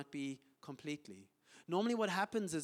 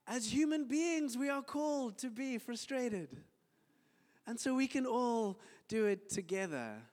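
mock sympathy; 9 semitones higher, 4 dB louder than usual. Mock invitation to wallow together.

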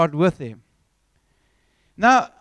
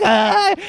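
loud, high crazed "aah"; sudden wild yelp of a madman; madman's cry; 5 semitones higher, 7 dB louder than usual. Retold or imitated. Preacher mimicking David feigning madness, crazed cry. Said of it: "acting like a crazy person".

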